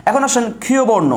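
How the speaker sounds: ordinary delivery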